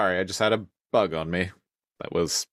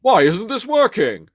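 whiny villain voice; 12 semitones higher, 7 dB louder than usual. Retold or imitated. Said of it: "he's like".

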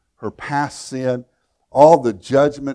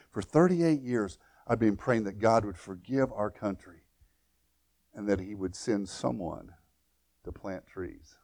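softer and softer, fading almost to nothing; tender retold prayer; 3 semitones lower, 8 dB quieter than usual. Preacher voicing the Ethiopian mother's answered prayer, hushed and fading. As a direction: let it sink into a hush.

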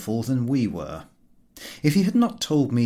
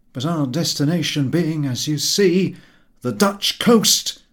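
put-on old sailor voice; 4 semitones higher, 4 dB louder than usual. A narrator's reading. Captain's dialect speech voiced.

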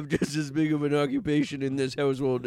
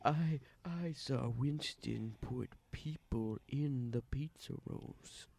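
whispered tattle; 4 semitones lower, 12 dB quieter, much slower than usual. Acted. Tattling on the chef secretly.

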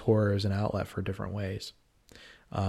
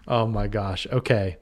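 ordinary talk

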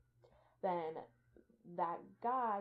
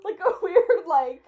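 high, loud laughing talk; cracking up while talking; laughing hard; 10 semitones higher, 17 dB louder than usual. Real, not acted. GM laughing at saunter.

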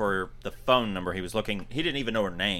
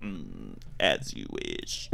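very deep, slow djinn voice; low, soft, drawn-out menace; Wishmaster; 8 dB quieter, much slower than usual. Retold or imitated. Djinn granting Kane Hodder's wish, deep and ominous.

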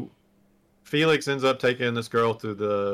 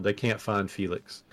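ordinary talk, nothing marked

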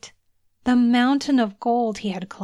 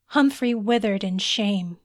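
usual speaking voice

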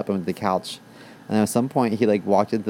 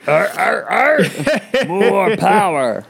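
much higher, louder laughing sitcom grunts; laughing ape-like grunts, then a slow drawn-out catchphrase; Tim Taylor grunt; 9 semitones higher, 5 dB louder, much slower than usual. Pretend. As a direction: grunt like a tool-happy sitcom dad. Recalling Home Improvement's Tim Taylor.

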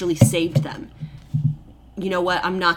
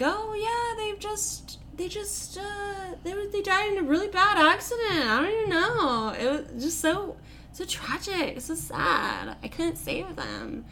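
much higher put-on voice, hammed-up fake sorrow; mock grief; 10 semitones higher, 5 dB quieter than usual. Queen mother rehearsing her lie to her son, falsely mournful. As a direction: play a scheming old woman faking tears.

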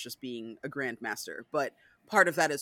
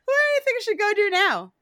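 put-on high, loud, breezy girl voice; childlike mock-casual voice; mock Claudia; 9 dB louder, faster than usual. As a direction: toss it off like nothing happened, pitched up. Acting Claudia and Louis dialogue.

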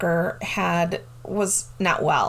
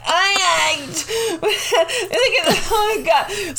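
high, loud, breathless laughter; overwhelmed giggle at the win; stunned laughing; 11 semitones higher, 4 dB louder, slower than usual. Retold or imitated. Retold jackpot win disbelief.

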